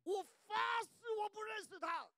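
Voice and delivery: high, slow shout; shouted oath. Retold or imitated. Preacher shouts Peter's oath, high and slow. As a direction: scream the oath.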